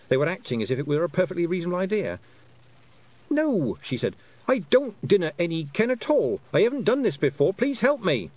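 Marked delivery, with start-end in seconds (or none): 3.3-3.8 distressed denial. A narrator's reading. Girl admits she is lost. 4.5-8.3 high, loud, exasperated plea; pleading girl. A narrator's reading.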